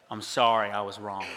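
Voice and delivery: low, sinking voice, retold apology; humbled apology. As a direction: let it drop low and quiet, admitting fault.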